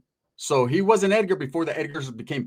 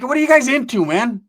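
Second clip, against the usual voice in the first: raised, high, loud indignation; retold angry outburst on the phone; angry and upset; 7 semitones higher, 9 dB louder than usual. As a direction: demand answers, getting heated. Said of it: "I'm getting angry and upset".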